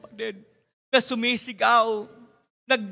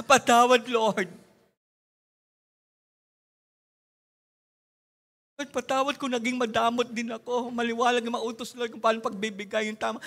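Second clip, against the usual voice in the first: repentant prayer. Acted.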